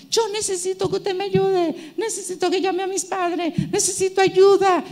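high, pleading schoolgirl voice, near tears; pleading girl. Retold or imitated. A schoolgirl begs the preacher to call her parents, high and pleading. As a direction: beg for help like a teenage girl about to cry.

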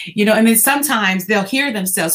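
ordinary talk, nothing marked

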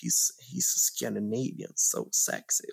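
swooning admirer. Retold or imitated. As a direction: sigh it like a smitten fan. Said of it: "undrar om det är någon som bara".

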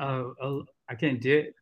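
usual speaking voice

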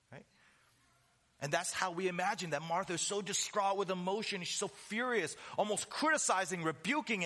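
ordinary talk

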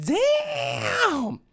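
very high squeaky damn; a stunned high-pitched gasp of a word. Retold or imitated. Reaction to the porn.

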